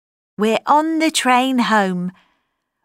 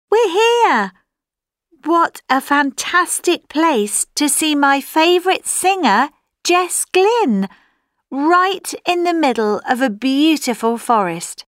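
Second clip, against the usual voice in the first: higher-pitched, thrilled arrival; excited; 3 semitones higher than usual. Acted. Fan posting on arriving at a forest concert, excited. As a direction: gush like a fan who has just arrived.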